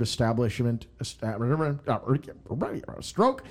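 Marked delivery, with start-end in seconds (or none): 3.0-3.4 garbled, jumping high on stroke; fake stroke. Pretending to have a stroke after a misread, high yelp. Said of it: "I had to pretend like I was having a stroke".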